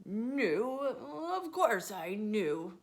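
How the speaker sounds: flustered sailor, slow on course; sputtering. Acted. Caught sailor sputters to grandmother. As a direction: bluster to cover it. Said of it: "he sputtered".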